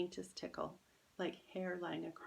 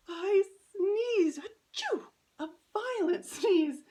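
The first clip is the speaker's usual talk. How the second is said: acted sneeze; 14 semitones higher, 12 dB louder, slower than usual. A narrator's reading. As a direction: sneeze it out, much higher and louder. Narrator acts out the sneeze.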